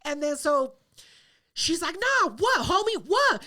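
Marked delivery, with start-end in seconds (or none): 1.9-3.4 higher, punchy challenge; mock confrontational shouting; imitated yelling. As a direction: yell it like someone itching to fight. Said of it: "She's yelling".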